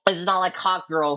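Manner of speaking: ordinary talk, nothing marked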